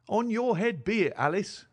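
high-pitched cry of reproach; reproachful cry. A narrator's reading. Mother gives in to her daughter, reproachful cry. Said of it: "cried her mother".

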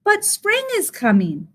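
high, bright-eyed little bird voice; high-pitched chirpy bird; chirping bird. A narrator's reading. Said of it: "Bird chirped".

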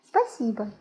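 ordinary talk, nothing marked

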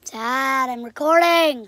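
loud call to another room. Real, not acted. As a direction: raise your voice to reach dad. Calling out to dad.

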